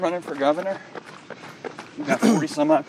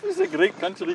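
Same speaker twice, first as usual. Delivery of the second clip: fake Indian accent. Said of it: "fake Indian accent".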